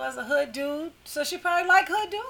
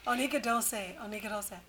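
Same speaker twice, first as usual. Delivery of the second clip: softer, lower aside to herself; muttered self-warning; 5 semitones lower, 9 dB quieter, faster than usual. Acted. Host warning herself off a comment, muttered low. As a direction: hold yourself back under your breath.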